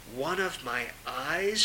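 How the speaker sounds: soft, slow, wondering to herself; hushed and slow, thinking aloud; quiet inner thought. Acted.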